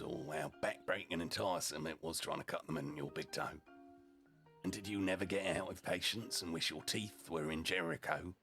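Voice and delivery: ordinary delivery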